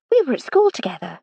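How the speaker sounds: a touch of eagerness, keen to share; lightly eager, leaning in to the memory. A narrator's reading. Old schoolfriend recalling school days, mildly eager. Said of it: "said Miss Titmuss eagerly".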